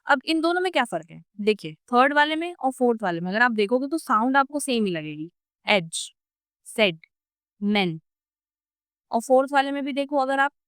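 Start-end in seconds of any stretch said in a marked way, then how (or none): 5.7-8.0 एज, सेड, मैन slow with a mid-open e; slow demo of the mid front vowel; mid level e. Shown as a demonstration. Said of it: "third वाला है वो mid level sound है".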